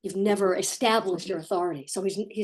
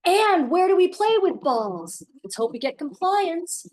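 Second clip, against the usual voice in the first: higher, slow, sugary coaxing; mock gentle parent; 9 semitones higher, 4 dB louder, slower than usual. A parent mocks expert-style coaxing of a child, high and slow. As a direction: coo at the kid like the experts advise. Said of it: "now you're gonna connect from his perspective".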